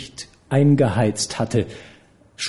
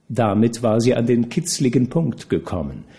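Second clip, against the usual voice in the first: ordinary delivery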